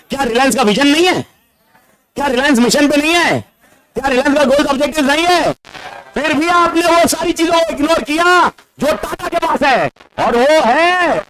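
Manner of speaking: high-pitched, insistent rhetorical questions; worked-up challenge. Real, not acted. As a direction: push your voice way up, hammering the point at the audience. Worked-up rhetorical questions, voice rising sharply. (जोश में ऊँची आवाज़ से सवाल दागते हुए)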